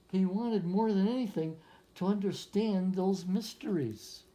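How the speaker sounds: put-on reading a picture book aloud to children; story narration. A narrator's reading. Teacher reading a picture book to young students, storytelling voice. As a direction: lift the lines like a storyteller for a class.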